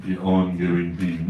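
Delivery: The ongoing theme said low and slow; deep dramatic voice. Shown as a demonstration. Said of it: "repeated in a low voice".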